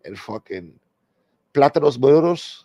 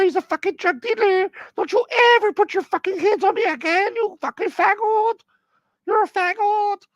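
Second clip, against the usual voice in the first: mock J-Lo. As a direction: scold him like an angry diva. [name] voice nagging [name].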